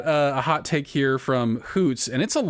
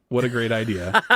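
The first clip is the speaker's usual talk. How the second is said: usual speaking voice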